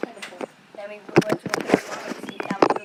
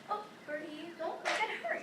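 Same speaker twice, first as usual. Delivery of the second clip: soothing; 5 semitones higher, 9 dB quieter than usual. Real, not acted. Soothing trapped bird softly.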